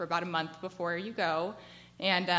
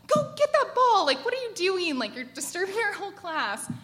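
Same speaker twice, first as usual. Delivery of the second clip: loud, high furious shout at the student; angry yell; 11 semitones higher, 7 dB louder than usual. Retold or imitated. Teacher yelling at a student who swatted the ball away, angry shout. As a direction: yell at him to fetch the ball. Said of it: "and I'm so angry".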